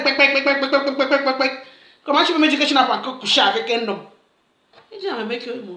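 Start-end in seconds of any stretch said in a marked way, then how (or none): 0.0-1.5 grasshopper hopping noises. Retold or imitated. Imitating grasshopper hops.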